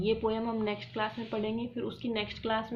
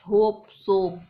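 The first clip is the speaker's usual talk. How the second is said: होप, सोप said louder, ending ओप stressed; loud rhyme pair; 8 dB louder, much slower than usual. Shown as a demonstration. Teacher calling out rhymes loudly. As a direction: hit the ओप. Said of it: "ठीक है, same है".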